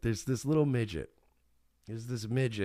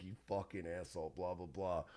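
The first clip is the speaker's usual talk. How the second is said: muttered under breath; 3 semitones lower, 13 dB quieter than usual. Retold or imitated. Friend mutters back at teller.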